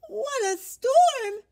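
high, loud, slow bird chirp; twittering wren. A narrator's reading. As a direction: trill it out like an excited little bird. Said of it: "twitters wren".

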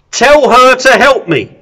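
high, loud demand; angry command. Acted. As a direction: order Jesus angrily. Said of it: "Martha is pretty angry".

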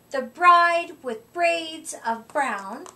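plain reading voice

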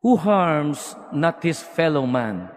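usual speaking voice

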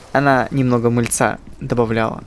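ordinary talk, nothing marked

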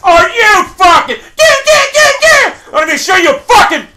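real rage, high and loud; yelling at the screen in fury; cracking angry scream; furious shout. Real, not acted. Player rages at game death.

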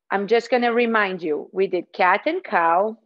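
ordinary talk

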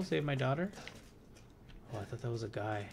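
ordinary talk, nothing marked

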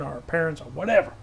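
usual speaking voice

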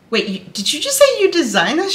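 high, rushed disbelief; starstruck. Retold or imitated. Fan questioning the pattern's designer, starstruck. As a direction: blurt it out fast, voice shooting up. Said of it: "starstruck immediately".

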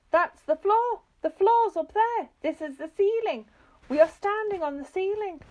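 high, loud panicked exclamations; screamed. A narrator's reading. Missus Twit convinced she is on the ceiling, screaming. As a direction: cry out each discovery in high alarm. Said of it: "screamed Missus Twit".

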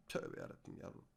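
whispered despair. Real, not acted. Player muttering to himself at a bad card, whispered. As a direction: breathe it out under your breath.

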